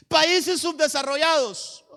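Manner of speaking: loud, high, slow branding; proclaimed accusation. Acted. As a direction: declare the label like a public verdict. Voices negative labels on country.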